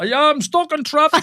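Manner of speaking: high, loud hammed-up shout; mock phone excuse. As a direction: call out a bogus excuse in a put-on high voice. Acts cup-carrier shouting excuses from taxi.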